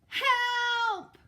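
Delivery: very high, very loud shout; shout for help. Acted. Eddie under the bear, very high loud shout. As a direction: scream for help. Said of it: "shouted Eddie".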